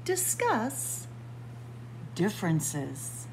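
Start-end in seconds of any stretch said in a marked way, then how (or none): none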